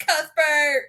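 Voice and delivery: high, loud cry of the name; excited call. Retold or imitated. Reader cheering for the guinea pig. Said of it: "I was like".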